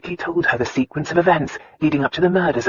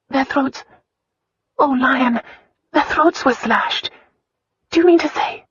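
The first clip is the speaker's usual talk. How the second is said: much higher, rising into alarm; alarmed young wife; 9 semitones higher than usual. A narrator's reading. Wife realising the same killer, rising alarm. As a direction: Christina realising the horror, pitch climbing. Christina voiced by narrator.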